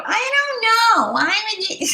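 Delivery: put-on very high, slow, drawn-out kid whine; mocking whine. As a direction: mimic a whining six-year-old back at them. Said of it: "I'd be tempted to mimic them".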